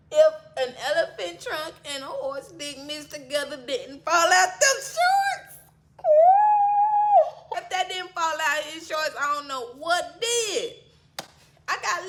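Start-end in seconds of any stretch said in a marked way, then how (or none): 0.1-9.1 astonished exclaim. Real, not acted. Telling friends what she saw, astonished shout. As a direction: shout it in disbelief. Reacting to his size.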